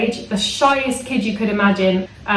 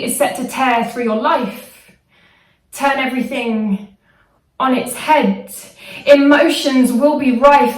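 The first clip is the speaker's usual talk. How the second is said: measured, emphatic poetry performance; spoken-word weather forecast; 4 semitones higher than usual. Acted. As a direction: deliver the poem like a grave weather warning.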